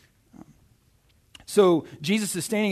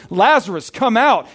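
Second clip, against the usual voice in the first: loud, higher commanding shout; retold ringing command; loud cry; 6 semitones higher, 8 dB louder than usual. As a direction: call out forcefully as if summoning someone. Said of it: "he cries out in a loud voice".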